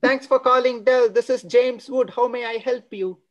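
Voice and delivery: polite support-line greeting; call center agent. Retold or imitated. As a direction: answer like a phone support agent. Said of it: "I find a relative".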